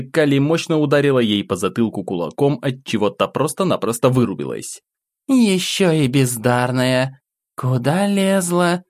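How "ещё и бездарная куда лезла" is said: slow, high, contemptuous demon voice; slow scornful demoness. A narrator's reading. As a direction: drawl it out with disdain. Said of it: "покачала головой демоница".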